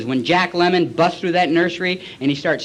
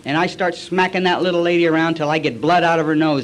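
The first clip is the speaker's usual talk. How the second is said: usual speaking voice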